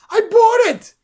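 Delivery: put-on very high, loud boyish exclamation; proud little boy. Boy telling his sister about the ring, proud and excited. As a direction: burst out proudly as the child.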